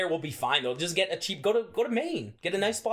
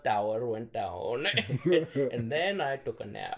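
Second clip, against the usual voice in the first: slow news anchor; much slower than usual. Retold or imitated. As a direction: read it out slowly like dull news.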